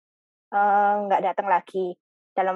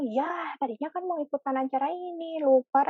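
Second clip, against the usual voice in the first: higher-pitched, mock dismay at forgetting; dismayed realisation; 6 semitones higher, 5 dB quieter than usual. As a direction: act out a rueful "oh no, I forgot". Acts out realising she forgot. (menyesal karena lupa, nada tinggi)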